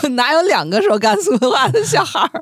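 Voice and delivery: high-pitched, laughing through the words; real laughter breaking into the question; laughing protest. Real, not acted. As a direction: protest in mock disbelief, cracking up. Teased, protests while laughing.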